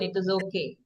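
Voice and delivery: soft, low, resigned; sad. Shown as a demonstration. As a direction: quietly let it go. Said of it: "in a sad tone".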